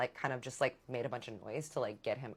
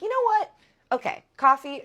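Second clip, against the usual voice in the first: a sudden high, louder, giddy burst; much higher, louder mock-excited agreement; mock giddy yes; 8 semitones higher, 7 dB louder than usual. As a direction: cave in with a squeal of mock delight. Caving in to his coffee offer.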